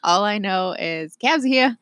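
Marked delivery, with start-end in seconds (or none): 1.1-1.8 put-on half-committed Jersey accent. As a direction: call it out like a Jersey Shore imitation. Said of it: "I can't even do a Jersey accent".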